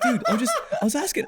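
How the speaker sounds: high, laughing protest of innocence; laughing exasperated plea. Retold or imitated. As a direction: squeak it out, half laughing, like you're wrongly accused. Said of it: "I was like".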